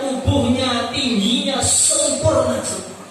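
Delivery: excited preaching. Acted. Preacher describing heavenly women.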